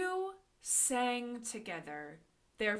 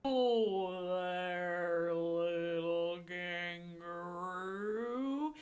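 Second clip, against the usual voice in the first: super slow sleepy sloth; 8 semitones lower, 5 dB quieter, much slower than usual. Acted. Sloth greeting in story.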